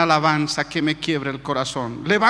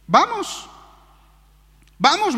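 high-pitched, eager quoted yes; eager acceptance; 10 semitones higher, slower than usual. Retold or imitated. Fiancée accepting the invitation to church, high and eager. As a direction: imitate a keen, high-voiced agreement.

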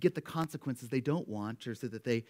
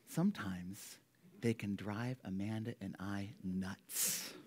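whisper; 5 semitones lower, 8 dB quieter, slower than usual. Real, not acted. Confiding aside about kids.

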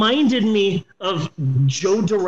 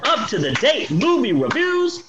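announcer sign-off; 8 semitones higher than usual. Pretend. Inviting listeners back next week, big announcer voice. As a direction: belt out the show title, climbing high at the end.

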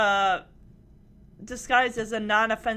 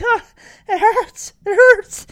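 mock cry of pain, louder and higher; hammed-up pain; 8 semitones higher, 11 dB louder than usual. Retelling Kimmy clutching her hurt shoulder, hammed-up cries. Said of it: "clutching her shoulder".

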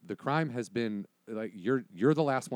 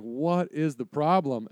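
slow, loud, raised demand; exasperated dad; 6 semitones higher, 6 dB louder, slower than usual. Acted. Dad losing patience with his frightened son, exasperated. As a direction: drag the words out in exasperation.

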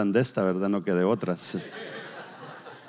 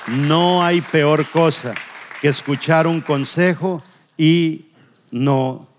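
higher, louder preaching at full force; 4 semitones higher, 4 dB louder than usual. Acted. Pastor warns his congregation about burning bridges, forceful preaching.

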